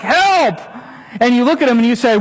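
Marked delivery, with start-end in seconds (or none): 0.0-0.6 put-on kid crying for help. A bleeding kid calls to the parent, mock yell. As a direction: call out like a kid who fell off a bike.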